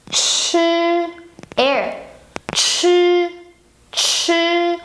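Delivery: "Chi" with rounded lips and air; lips pursed. Shown as a demonstration. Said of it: "and now you round your lips".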